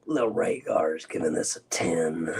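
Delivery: put-on deep voice. Host reading a chatter's rating, deep put-on voice. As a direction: drop way down into a low put-on voice. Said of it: "You sound like my uncle".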